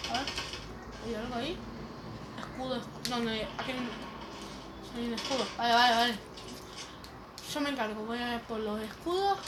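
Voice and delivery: usual speaking voice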